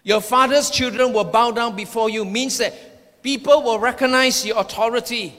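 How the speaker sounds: ordinary delivery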